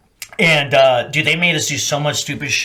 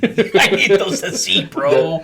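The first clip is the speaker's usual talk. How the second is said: higher, drawn out through laughter; laughing plea; 4 semitones higher, much slower than usual. Retold or imitated. Marine retelling broken glasses, laughing mock plea. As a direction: stretch the plea while laughing. Repeats the line while laughing.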